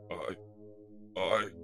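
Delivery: halting stammer with long gaps; stutter. A narrator's reading. Ultra Magnus overcome by memories, stuttering. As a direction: get stuck on the first word. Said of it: "he starts to stutter".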